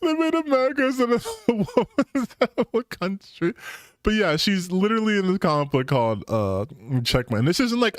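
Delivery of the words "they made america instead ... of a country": high, giggling repeat of the joke; can barely get it out for laughing; laughing through it. Real, not acted. Repeats line while laughing.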